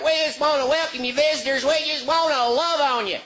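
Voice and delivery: high, syrupy pastor voice; gushing pastor. Retold or imitated. Pastor welcoming visitors, high syrupy gushing. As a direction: gush at the visitors. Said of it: "the pastor's like".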